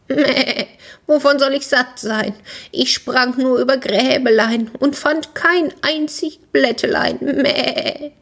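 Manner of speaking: high, bleating goat voice; high-pitched whingeing goat; complaining goat. A narrator's reading.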